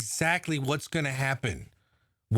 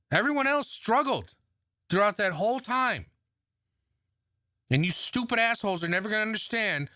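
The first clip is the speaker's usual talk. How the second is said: raised, heated voice; angry rant; 7 semitones higher than usual. Real, not acted. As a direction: hit it hard and high.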